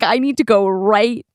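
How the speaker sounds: ordinary delivery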